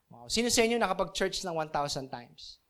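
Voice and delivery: ordinary talk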